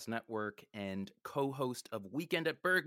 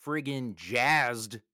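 slow and loud on jazzed; drawn-out hype; 6 dB louder, much slower than usual. Acted. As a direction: stretch out the hype word. Enthusiastic hype for guest.

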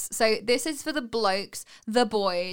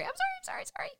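squeaky apology; 6 dB quieter, much faster than usual. Real, not acted. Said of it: "Bad [name]".

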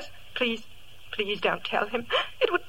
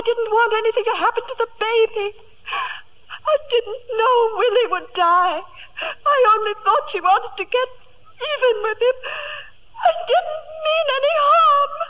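crying; 5 semitones higher than usual. Acted. Lady Fenwick breaks down crying.

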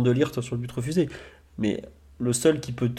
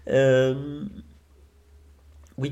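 ordinary delivery

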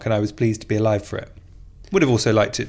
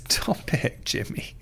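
laughing whisper; 7 dB quieter, slower than usual. Real, not acted. Embarrassed at praise, laughing.

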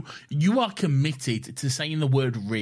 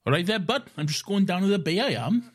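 lilting Welsh accent, lifted and a bit quicker; 5 semitones higher than usual. Shown as a demonstration. Showing his friend how a Welsh person would sound, in a Welsh accent. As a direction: sing it out like a Welsh lad off to the bay. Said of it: "you sound like Reese from Torchwood".

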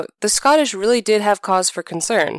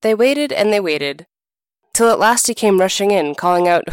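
plain reading voice

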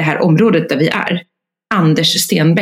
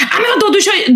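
blaming; 14 semitones higher, much faster than usual. Retold or imitated. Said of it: "jag bara blamear honom".

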